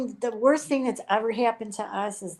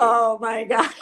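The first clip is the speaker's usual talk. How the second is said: loud, laughing disbelief; amused outburst; laughing exclamation; 2 semitones higher, 6 dB louder, slower than usual. Real, not acted. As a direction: exclaim it loudly through laughter. Laughing at being called sir.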